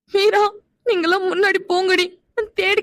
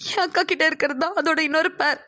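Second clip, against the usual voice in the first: crying; 4 dB quieter than usual. Acted. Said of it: "நீ அழாத".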